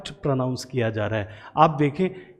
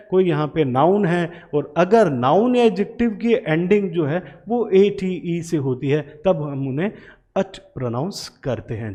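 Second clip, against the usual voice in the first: usual speaking voice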